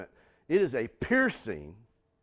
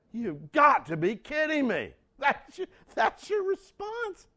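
incredulous; 14 semitones higher, 5 dB louder than usual. Retold or imitated. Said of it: "God's response to him is like".

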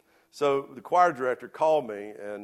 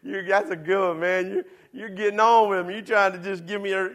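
high, loud laughing at the joke; laughing disbelief; 9 semitones higher, 9 dB louder than usual. Retold or imitated. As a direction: laugh it off, sure he's kidding. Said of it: "I was like".

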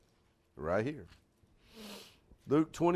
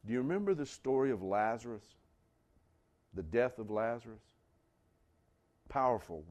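ordinary talk

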